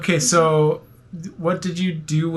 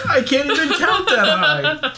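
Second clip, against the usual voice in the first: laughing; 11 semitones higher, 3 dB louder than usual. Real, not acted. Laughing joke.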